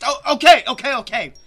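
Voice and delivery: high, loud, hurried surrender; flustered high-pitched yielding; alarmed giving in. Acted. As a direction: blurt it out loudly to stop the pulling. Giving in while being pulled.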